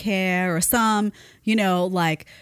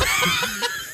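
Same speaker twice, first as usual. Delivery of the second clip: laugh. Real, not acted. Laughing at own joke.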